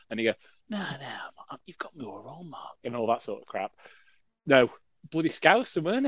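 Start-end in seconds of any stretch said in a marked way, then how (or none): 0.7-2.8 lightly voiced Scouse pleading, half whispered; a hint of a Scouse footballer begging, going breathless; mock Scouse. Host voices Kevin Gage pleading with him, mild mock Scouse. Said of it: "I didn't even get my voices right then".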